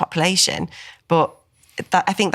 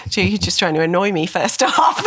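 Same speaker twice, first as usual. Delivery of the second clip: laughing; 3 semitones higher than usual. Real, not acted. Siblings laughing together.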